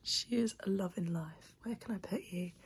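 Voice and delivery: sleepy half-whispered morning talk; drowsy, barely voiced. Real, not acted. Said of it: "Sorry for the morning voice".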